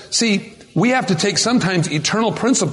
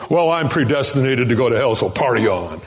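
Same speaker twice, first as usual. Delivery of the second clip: a hint of a careless shrug, dropping low at the end; careless sinner; 6 semitones lower than usual. Preacher mocking a careless believer, lightly voiced. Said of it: "some people then go".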